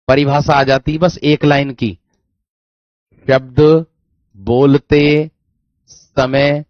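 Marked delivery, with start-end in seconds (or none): 3.3-6.6 शब्द, बोलते, समय, each word apart with long pauses; word-by-word dictation. Shown as a demonstration. Teacher dictating a one-line definition to students, word by word with pauses.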